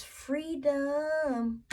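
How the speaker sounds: slow, savoured "It's freedom", each word stretched; drawn-out freedom. Real, not acted. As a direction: linger on "freedom" as if tasting it. Savouring card meaning.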